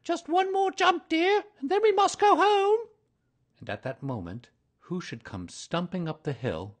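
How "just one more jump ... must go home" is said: very high, louder motherly kangaroo voice. A narrator's reading. Kanga coaxes Baby Roo to jump once more, very high. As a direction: coax a little one in a high sing-song. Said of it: "Kanga was fidgeting about and saying".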